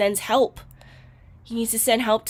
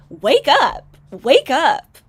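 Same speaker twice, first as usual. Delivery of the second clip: loud, punched "wake up"; raised voice, urging her to snap out of it; exasperated wake-up call; 4 semitones higher, 7 dB louder, much slower than usual. Pretend. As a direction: call out as if rousing someone deluded. Exasperated plea to deluded woman.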